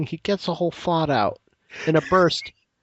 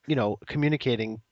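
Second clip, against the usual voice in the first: normal talking voice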